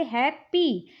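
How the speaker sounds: "Happy" in two slow beats, हैप्, पी; slow two-part "happy" for learners; broken into syllables. Shown as a demonstration. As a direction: separate the halves of "happy" with a short gap. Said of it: "इसे आप दो भागों में break कर रहे हैं".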